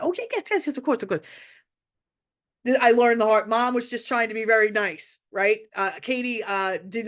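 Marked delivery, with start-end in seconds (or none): 0.0-1.3 pitched way up, a hasty yes yes of course; fake eager reassurance. Mom dodging the question with overeager politeness. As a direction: brush it off with a too-quick yes.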